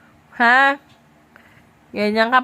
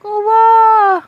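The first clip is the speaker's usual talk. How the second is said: high, loud drawn-out coo; mock pity; 8 semitones higher, 5 dB louder than usual. Parent teasing a scolded child, high mock pity. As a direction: pile on the playful pity, pitched up.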